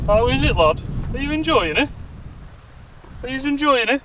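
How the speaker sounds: high, sing-song voice for the dog; pet talk. Real, not acted. Talking to dog in trailer.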